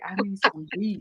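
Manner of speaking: low, soft sultry auntie; breathy come-on line, lightly; sexy auntie voice. Retold or imitated.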